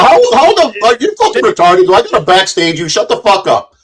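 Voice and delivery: high, agitated angry interruption; angry outburst. Real, not acted. Host talking over an accusing caller, real angry outburst. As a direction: cut in heatedly, pitch jumping up. Heated quarrel, interrupts guest.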